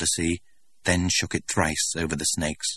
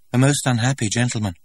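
put-on a lightly higher, curious young man; eager young stranger; 6 semitones higher, 4 dB louder than usual. A narrator's reading. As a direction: ask with wide-eyed eagerness. Stranger voiced by narrator.

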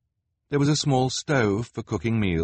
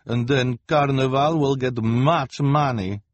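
put-on high, slow, loud accented boss; smug fortune-teller; Madame Lulu voice; 6 semitones higher, 5 dB louder than usual. A narrator's reading. Lulu gloats.

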